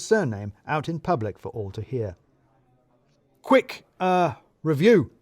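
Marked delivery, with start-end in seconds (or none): 3.4-5.1 higher, louder put-on boss; brisk boss summons. A narrator's reading. Boss summons Radu to his office, higher put-on voice.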